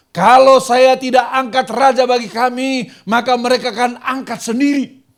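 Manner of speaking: loud echo of the ultimatum; loud retold demand. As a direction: repeat the crowd's threat at full volume. Samuel repeating people's ultimatum.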